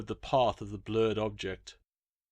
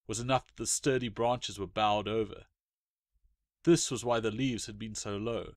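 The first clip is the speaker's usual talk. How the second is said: usual speaking voice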